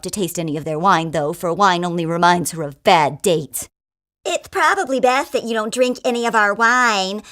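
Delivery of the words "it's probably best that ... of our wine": put-on camp voice, pitched higher. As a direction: play a camp innkeeper warning a guest.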